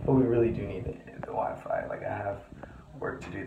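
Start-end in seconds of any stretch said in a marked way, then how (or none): none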